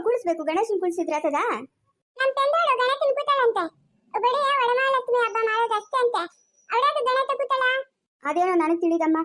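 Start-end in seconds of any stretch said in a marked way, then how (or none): 2.2-7.7 put-on high-pitched child pestering with questions; complaining little child. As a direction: demand answers in a high kid voice. (ದೂರು ಹೇಳುವ ಪುಟ್ಟ ಮಗುವಿನ ಧ್ವನಿ)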